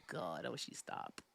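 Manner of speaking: unvoiced soft plea; whispered plea. Real, not acted. Begging the friend to stop the joke, whispered. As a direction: breathe out please stop.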